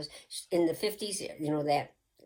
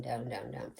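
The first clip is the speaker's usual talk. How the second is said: breathy hypnotist whisper sinking lower; whispered countdown; 5 semitones lower, 8 dB quieter than usual. Retold or imitated. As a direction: whisper the count down as if putting him under.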